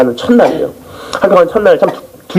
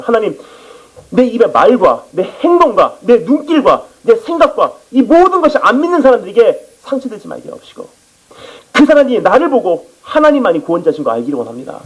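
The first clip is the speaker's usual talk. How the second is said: fervent prayer; 6 semitones higher than usual. Retold or imitated. Retold fervent train prayer. (높은 목소리로 천천히 간절하게 기도하듯)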